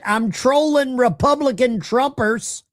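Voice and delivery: put-on high, whiny child voice; mock the prank caller as a kid begging mom. Host mocks prank caller's message in a high kid voice. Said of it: "Mike says".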